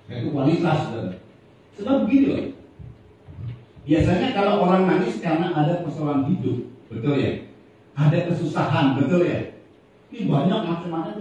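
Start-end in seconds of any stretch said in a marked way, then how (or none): none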